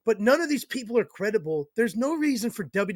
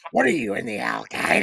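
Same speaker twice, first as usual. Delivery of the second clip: low-pitched impersonation of a wrestling boss; Vince McMahon; 9 semitones lower than usual. Retold or imitated. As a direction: drop the voice to mimic his blunt question. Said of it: "he goes".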